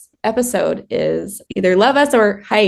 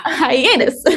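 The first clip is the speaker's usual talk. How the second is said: high laughing hi-ya on Hiatus; mock karate cry; 6 semitones higher than usual. Sister punning on hiatus, laughing mock karate cry. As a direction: chop the word like a karate shout.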